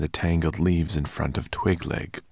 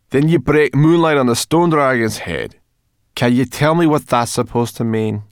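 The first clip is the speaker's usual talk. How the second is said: loud, high, scoffing brownie voice; mock the riddle as nonsense; skeptical brownie; 8 semitones higher, 12 dB louder than usual.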